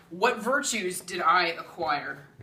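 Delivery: ordinary delivery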